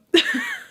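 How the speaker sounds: squeaky burst of laughter; a sudden high giggle. Real, not acted. Laughing at herself for being mean.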